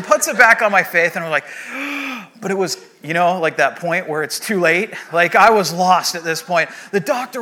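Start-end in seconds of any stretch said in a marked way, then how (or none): 1.5-2.4 big sucking gasp for air; relieved gasp. Retold or imitated. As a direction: gulp in air at last. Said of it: "opens up the oxygen".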